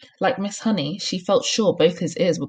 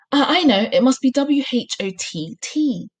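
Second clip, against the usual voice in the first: higher, louder burst of confidence; sudden realisation; 6 semitones higher, 4 dB louder than usual. A narrator's reading. Boy guessing a spelling for his teacher, sudden realisation. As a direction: blurt it out as if solved.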